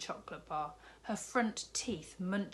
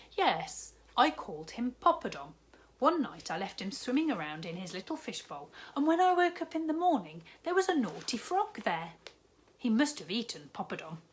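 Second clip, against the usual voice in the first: a jolly newsagent, lightly voiced, cheerful; kindly shopkeeper; 4 semitones higher, 6 dB louder than usual. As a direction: play the big jolly shopkeeper, bargaining kindly. Raj figure voice.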